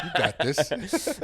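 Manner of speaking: slow, high, laughing hype-up; laughing pep talk. Pretend. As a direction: cheer him on through a laugh. Hyping friend up, laughing.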